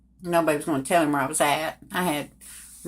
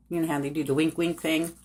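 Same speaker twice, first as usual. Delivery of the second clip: plain reading voice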